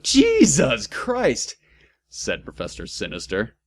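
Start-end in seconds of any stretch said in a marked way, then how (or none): 0.0-1.6 exasperated exclamation. Acted. Professor exasperated outburst.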